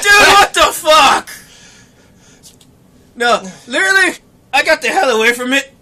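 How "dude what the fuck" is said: high, loud, drawn-out disbelief; mock shocked yell. Friend who is not interested reacts, mock shocked yell. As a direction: cry out slowly in comic shock. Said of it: "He's like".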